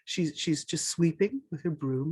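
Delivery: ordinary delivery, nothing marked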